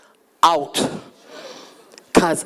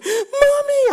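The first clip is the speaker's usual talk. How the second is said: panicked scream. Retold or imitated. Daughter screaming for mom from the hallway, panicked.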